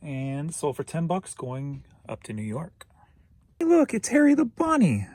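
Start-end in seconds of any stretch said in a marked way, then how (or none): 3.6-5.1 high, loud delighted squeal; excited squeal. Real, not acted. Seller squealing at a bunny toy on the pile.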